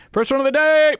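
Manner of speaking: mock-triumphant yell before the run; fake hype shout. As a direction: shout it high and loud as a joke. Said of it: "Just fucking kidding".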